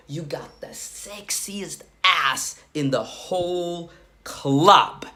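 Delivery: slow, drawn-out come-on, partly whispered; sexual opener.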